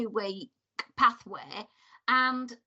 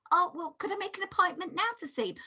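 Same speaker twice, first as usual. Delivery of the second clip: mock pleading; 7 semitones higher, faster than usual. Quotes herself pleading.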